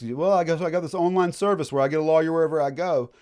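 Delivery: a hint of sarcastic nonchalance; mock casual shrug. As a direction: toss it off sarcastically. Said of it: "It's not like you just".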